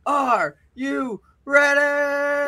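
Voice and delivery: milk the arena for noise; drawn-out crowd shout. Acted. Champion asks crowd again.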